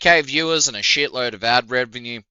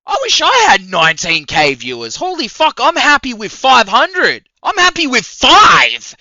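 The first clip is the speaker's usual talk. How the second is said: incredulous rant; 7 semitones higher, 6 dB louder than usual. Real, not acted. Ranting, comparing his own tiny viewership.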